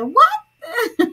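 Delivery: squealed what through laughter; laughing high what. Retold or imitated. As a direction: quote her high-pitched, laughing "What?". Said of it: "she said".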